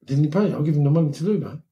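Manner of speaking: high-pitched, rushed incredulous retelling; acted disbelief. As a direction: act out being baffled on the phone. Retold phone call, acted surprise.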